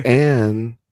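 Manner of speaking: ordinary talk, nothing marked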